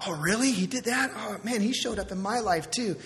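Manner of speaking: an excited friend answers a testimony, high and eager; excited chatter. Retold or imitated. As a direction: gush like a friend hearing good news. Imagined churchgoers swapping stories.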